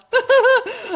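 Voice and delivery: high peals of laughter; amused burst of high laughter; real laugh. Real, not acted. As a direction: laugh out loud, high and freely. Both crack up over mint joke.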